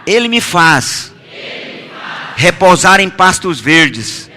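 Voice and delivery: declaimed psalm, phrase by phrase; leading congregational recitation. Real, not acted. Preacher leading congregation through the psalm, measured declamation. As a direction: proclaim each phrase and leave room for the echo.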